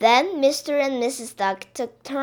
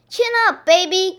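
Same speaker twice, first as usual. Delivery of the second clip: high, loud cheering-on call; 9 semitones higher, 8 dB louder than usual. A narrator's reading. Missus Duck encouraging lagging Baby, calling out. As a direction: call out to buck up a child. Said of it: "called Missus Duck".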